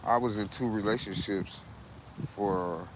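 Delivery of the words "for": ordinary delivery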